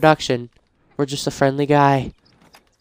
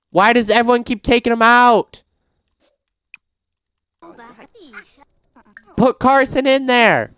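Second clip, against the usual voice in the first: higher, louder exasperated complaint; frustrated outburst; 10 semitones higher, 3 dB louder than usual. Real, not acted. Gamer fed up with sims moving the baby, frustrated outburst. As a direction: let the frustration burst out. Frustrated that child keeps leaving.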